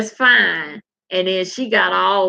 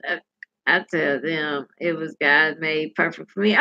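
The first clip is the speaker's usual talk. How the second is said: usual speaking voice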